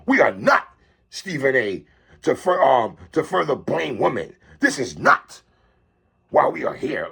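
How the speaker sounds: imitating her with low, weighty emphasis; mock emphatic tone. Host mocks Malika's on-air rebuke of Stephen A, exaggerated emphasis. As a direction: lean on each word, mimicking her low and stern. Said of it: "what's up with the emphasis".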